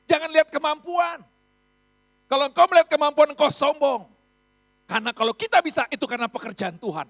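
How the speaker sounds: loud, emphatic sermon warning; preaching at full force. Acted. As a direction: thunder it at the congregation. Pastor warns against pride. (khotbah lantang penuh tekanan)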